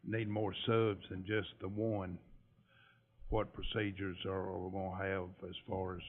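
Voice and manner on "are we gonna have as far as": usual speaking voice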